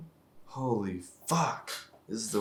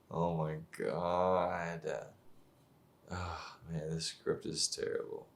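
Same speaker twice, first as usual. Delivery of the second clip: weary groan; 5 semitones lower, 6 dB quieter, slower than usual. Real, not acted. Groaning at the bad script.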